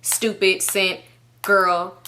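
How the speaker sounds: slow, separated words, level pitch; line-by-line texts. Retold or imitated. Reading his one-line texts aloud, slow and piecemeal. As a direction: leave a gap after each word like separate messages. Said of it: "he would just text one line".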